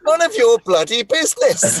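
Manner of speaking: high, loud comic rebuff; mock indignant retort. Answering a nosy stranger, high mock indignation. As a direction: send the stranger packing, hammed-up.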